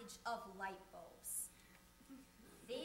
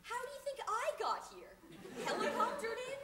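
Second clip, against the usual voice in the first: high, loud comic disbelief; mock indignant retort; 8 semitones higher, 5 dB louder than usual. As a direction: fire back incredulously, pitched up. Acted retort to a latecomer.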